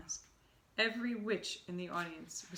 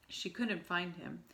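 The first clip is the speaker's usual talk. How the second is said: ordinary delivery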